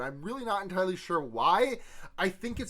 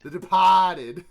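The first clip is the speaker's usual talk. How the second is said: loud, drawn-out Boston "De-PAAAH-ted"; hammed-up Boston copy of "Departed"; mock Boston accent; 10 dB louder, slower than usual. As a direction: exaggerate a Boston "Departed", long open "ah" and no r. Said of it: "you're allowed to do that accent".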